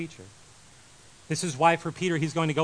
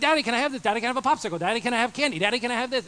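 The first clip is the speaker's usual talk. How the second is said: put-on higher pleading child voice, a touch louder; kids asking daddy; 7 semitones higher, 4 dB louder, faster than usual. His kids beg daddy for popsicle and candy, higher childlike pleading. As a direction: beg like a little kid for treats. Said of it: "they're like".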